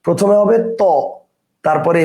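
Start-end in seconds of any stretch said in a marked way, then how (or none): 0.6-0.8 "ত" as a lone syllable; broken down by sound. Shown as a demonstration. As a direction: isolate "ত" as an open to. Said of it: "উচ্চারণ অনুসারে ভাঙি".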